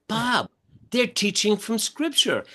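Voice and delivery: high, amused mock alarm; high startled wife, lightly voiced; surprised wife. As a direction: play her shocked whisper-turned-exclaim. Wife quoted in church, surprised.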